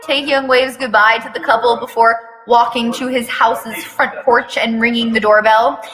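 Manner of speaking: plain reading voice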